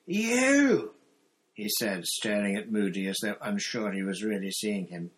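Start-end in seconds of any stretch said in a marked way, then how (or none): 0.0-0.9 high shout of fury and fear; furious shout. A narrator's reading. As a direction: shriek it, shocked and enraged. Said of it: "A terrible look of mingled fury and fear".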